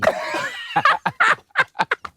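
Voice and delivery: high wheezing laugh breaking out; burst of laughter. Real, not acted. Laughing hard at his own grade. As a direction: crack up at the memory.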